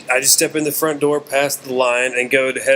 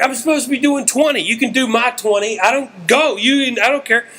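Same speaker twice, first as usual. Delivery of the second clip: high, tumbling fan's offer; starstruck babbling; 9 semitones higher than usual. Retold or imitated. Opener offers star his twenty minutes, starstruck. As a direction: insist eagerly in a raised pitch. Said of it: "I'm so starstruck".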